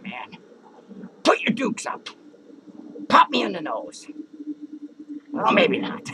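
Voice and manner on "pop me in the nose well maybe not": ordinary delivery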